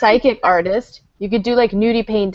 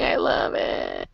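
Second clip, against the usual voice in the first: whispered coo; 8 dB quieter, slower than usual. Real, not acted. Cooing over dog.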